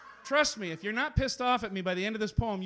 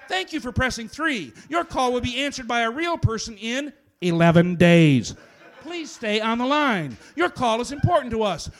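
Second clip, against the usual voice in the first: louder phone menu voice; 3 semitones higher, 6 dB louder than usual. Acted.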